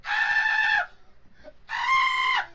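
short, loud, very high shrieks; hammed-up squeals of panic; high-pitched screams. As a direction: let out piercing play screams. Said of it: "I screamed like a B I T C H".

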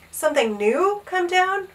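high, incredulous question; gasped surprise. A narrator's reading. Page boy astonished by the king's wish, gasping. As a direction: ask it in storybook astonishment. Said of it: "Bartholomew gasped".

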